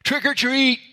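put-on high, bright little kid call; kid shouting. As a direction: call it out like a child at the door. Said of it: "by just shouting the magic words".

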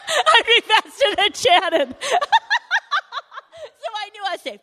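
high laughter through the words; cracking up at her own joke; laughing. Real, not acted. Joking about outrunning Shannon, laughing high and loud. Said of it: "we're laughing all about that now".

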